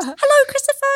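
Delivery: very high sing-out mum greeting; imitating mum. Daughter impersonating mum on Skype, high imitated mum voice. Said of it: "that's what my mom goes".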